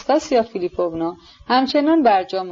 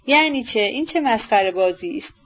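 ordinary delivery

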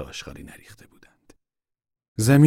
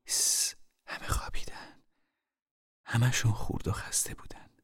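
hushed whisper so others sleep; 5 semitones higher, 8 dB quieter than usual. Acted. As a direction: whisper it, everyone is asleep. Mother hushes in sleeping camp.

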